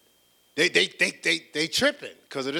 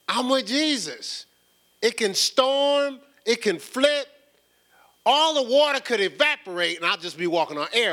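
preaching at full force; 10 semitones higher, 7 dB louder than usual. Real, not acted. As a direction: shout the faith over the storm. Preaching climax on faith.

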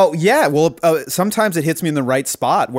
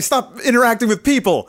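high mock hustle-guru rant; piling on in a pitched-up parody voice; Gary Vee parody; 10 semitones higher than usual. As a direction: badger them like a hammed-up motivator. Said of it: "we make fun of that Gary Vee".

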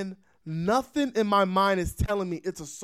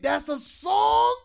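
stunned realisation; 11 semitones higher, 5 dB louder, much slower than usual. Retold or imitated. Sudden realisation it was music.

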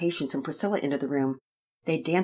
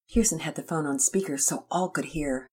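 ordinary delivery, nothing marked

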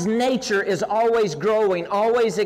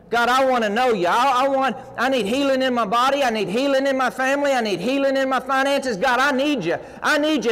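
pleading cry to God; 3 semitones higher than usual. Acted. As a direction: cry out to God like you mean it. Preacher acts out desperate prayer.

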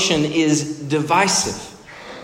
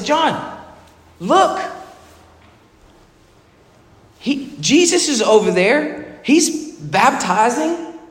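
high, needling mock voice of the disciples; hammed-up high taunt; goading disciples; 10 semitones higher, 4 dB louder, slower than usual. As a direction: poke at him like a jealous follower. Disciples goading John, retold.

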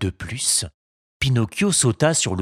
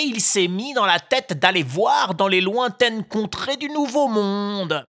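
a gently voiced storytelling bird; high pigeon voice; 13 semitones higher than usual. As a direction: pigeon explaining, a little sad. Pigeon tells of Geppetto.

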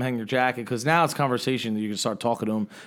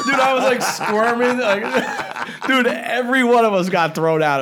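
high, loud laughing story ending; laughing hard; 9 semitones higher, 5 dB louder than usual. Real, not acted. Recalling getting thrown out, laughing. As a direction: tell it cracking up.

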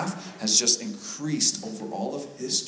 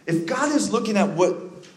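high, urgent preaching that grows louder; impassioned preaching; 9 semitones higher, 5 dB louder than usual. Real, not acted. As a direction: drive the sermon up toward a climax.

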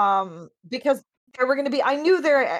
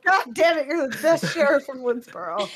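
gruff barking police captain; 4 semitones higher than usual. Acted. Repeats the captain rant.

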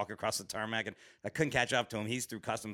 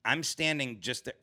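ordinary talk, nothing marked

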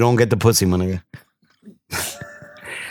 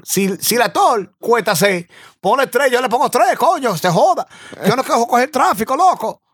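higher mock Dominican Spanish cab driver; 9 semitones higher than usual. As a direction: Dominican cabbie rattling off Spanish, pitched up.

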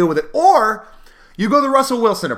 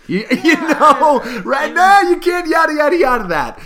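laughing disbelief; 9 semitones higher, 3 dB louder than usual. Real, not acted. Laughing incredulous retort.

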